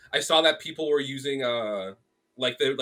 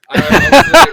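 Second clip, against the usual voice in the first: loud, high excited burst; can't contain the thrill; excited outburst; 12 semitones higher, 19 dB louder than usual. Real, not acted. Telling the host about the manga, loud excited burst.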